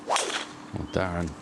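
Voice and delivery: usual speaking voice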